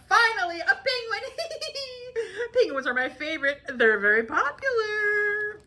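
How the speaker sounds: high, laughing and tearful joy; overjoyed squeal. Acted. Penguin fan meeting a penguin, high and laughing with joy. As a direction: gush with happy tears.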